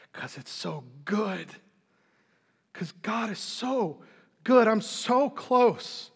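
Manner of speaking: slow, savoured declaration of delight; heartfelt joy. Acted. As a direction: draw out each word in rapt joy. Acted joyful answer to a doubter.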